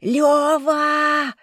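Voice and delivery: loud, high, long bellow; straining yell. A narrator's reading. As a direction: scream the name at full strain. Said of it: "продолжала надрываться Инна".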